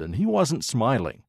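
usual speaking voice

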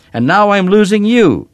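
high-pitched, loud, anguished shout. A narrator's reading. Daughter tells Papa she is losing him, shouting. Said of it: "I shouted".

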